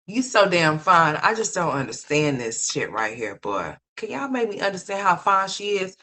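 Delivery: ordinary talk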